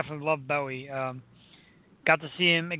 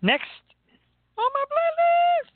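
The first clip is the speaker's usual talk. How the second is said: high announcer sing-song; drawn-out radio jingle voice; 6 dB louder, slower than usual. Pretend. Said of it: "That was horrible".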